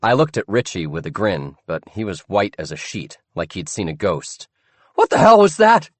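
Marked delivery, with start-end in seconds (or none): 5.0-5.9 high, loud startled squeak; a scared boy blurting in shock. Acted. Richie squeaking in fright after the crash. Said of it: "he squeaked".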